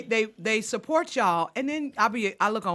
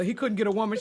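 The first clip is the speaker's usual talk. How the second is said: ordinary talk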